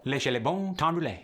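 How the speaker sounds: quick French "Laissez les bons temps rouler"; French Cajun phrase. Shown as a demonstration. Coach saying the Cajun motto in French. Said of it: "Let the good times roll".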